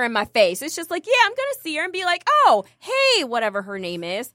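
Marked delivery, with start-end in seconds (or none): 2.4-3.2 fake-friendly greeting. Imagined greeting of old rival.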